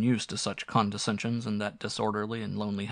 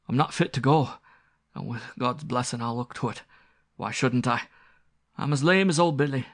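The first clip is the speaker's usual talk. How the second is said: rustic squire, higher, earnest and unsettled; repentant country squire; 4 semitones higher, 3 dB louder than usual. Acted. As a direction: vow reform haltingly, then with growing force.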